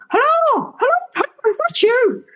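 high-pitched mock Irish accent; hammed-up Irish phone greeting. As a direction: imitate an Irish uncle on the phone, pitched right up. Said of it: "Sorry about the Irish accent".

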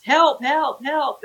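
high, repeated mock pleas; mock cry for help. Caller ignores the dispatcher's address question, mock cry for help. Retold fake emergency call.

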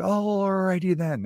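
high, slow, goofy catchphrase; Jim Carrey. Acted. Reminding listeners of the movie line, hammed-up impression. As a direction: stretch the first word way out, then drop. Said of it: "Remember, he was like".

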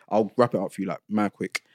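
normal talking voice

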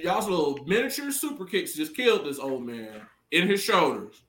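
mock sarcasm. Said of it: "I'm like".

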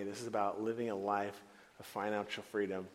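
normal talking voice

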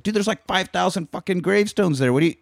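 high-pitched frantic demand for the grave. Retold or imitated. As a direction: squeal it, desperate to know. Tuco frantic about the gravestones.

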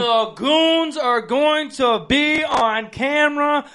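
loud, high and drawn out like a hype man; hyped up. Real, not acted. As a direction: sell it big, stretch the words. Hyping next week's new studio.

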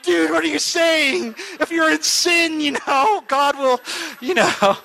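high, slow disbelieving rebuke; incredulous. Retold or imitated. Speaker rebuking the pastor for lying, slow high disbelief. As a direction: drag it out, high and appalled. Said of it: "I looked at him like".

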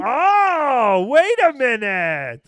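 loud, slow surprised oh; drawn-out wait a minute. Real, not acted. Surprised reaction.

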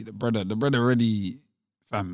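usual speaking voice

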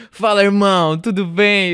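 loud, high, hearty Brazilian Portuguese hello; Brazilian Portuguese phone greeting. Retold or imitated. Friend imitates Clem answering the phone in Brazilian Portuguese. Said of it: "super Portuguese boy".

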